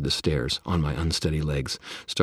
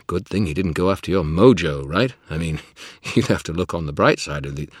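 joking sidekick voice; 8 dB louder than usual. Acted. Bob joking.

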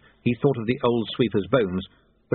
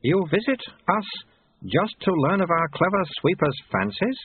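high, incredulous abbot voice; high-pitched holy man; questioning abbot; 7 semitones higher than usual. A narrator's reading. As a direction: question the pupil in a raised voice.

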